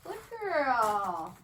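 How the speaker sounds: bright, loud praise for the dog; cheerful praise to a pup. Real, not acted. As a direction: reward her with a happy voice. Praising dog during training.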